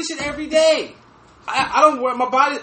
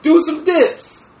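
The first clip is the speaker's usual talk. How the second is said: slow mock advice; 5 semitones higher, much slower than usual. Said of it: "I was like".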